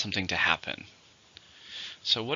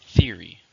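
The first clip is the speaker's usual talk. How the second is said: Theory, with "THE" hit loud; stress on the first syllable; 6 dB louder than usual. Shown as a demonstration. Teacher drilling the word for the class, stress on "THE". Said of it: "Two, stress on the first".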